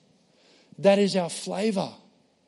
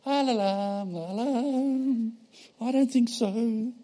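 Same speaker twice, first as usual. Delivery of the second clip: higher, sing-song la la, slow dismissive I don't think so; dodging la la la; 6 semitones higher, slower than usual. Retold or imitated. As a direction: pretend not to hear, then brush it off slowly. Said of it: "You know what most of us do?".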